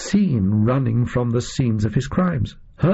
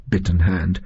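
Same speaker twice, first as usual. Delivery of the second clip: ordinary delivery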